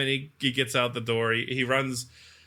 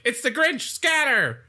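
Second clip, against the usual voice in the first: panicked cop quote; 11 semitones higher than usual. Retold or imitated. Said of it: "he's like".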